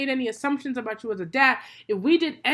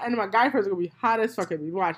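usual speaking voice

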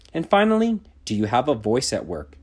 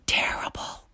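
whisper; 6 dB quieter than usual. Real, not acted. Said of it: "answers own question in whisper".

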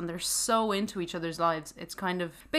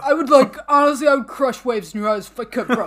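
mock bro gushing; 4 semitones higher, 10 dB louder than usual. Playful bro gushing continues.

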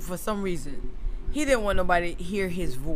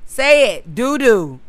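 put-on loud, higher prompting of a child; insistent coaxing; 4 semitones higher, 8 dB louder, slower than usual. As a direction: press the kid to say the word. Retold coaxing a silent child.

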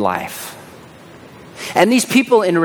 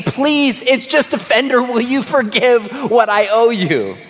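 high, pleading request for mercy; begging the lady to forgive the debt; pleading plea; 7 semitones higher than usual. Retold or imitated. A high-school kid begging the lady to forgive his debt, pleading. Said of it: "And I remember saying".

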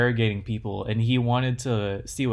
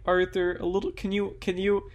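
high, pleading little voice; small man asking for help; 6 semitones higher than usual. Retold or imitated. Coworker asking Arthur to reach the lock, high pleading. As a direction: ask for help sheepishly, pitched up. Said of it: "he just turned around and was like".

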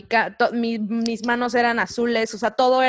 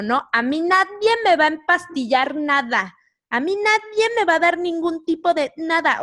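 defiant refusal; 6 semitones higher than usual. Retold or imitated. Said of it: "como dijo Susana".

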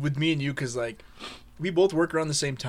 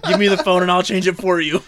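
louder, higher petty retort; snapping back; 5 semitones higher, 8 dB louder than usual. Retold or imitated. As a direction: snap back, fed up. Retold snapping back at girlfriend.

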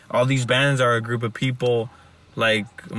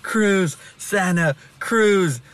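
drawn-out, higher stage announcement; live-show frontman; 6 semitones higher, much slower than usual. Retold or imitated. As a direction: imitate a rock singer hyping the crowd, slowly stretching the name. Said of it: "in their live shows".

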